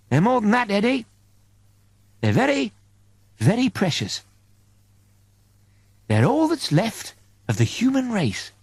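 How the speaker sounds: an angel fondly explaining the crew; angel's voice. Acted. Angel telling Eddie why he was chosen, lightly voiced.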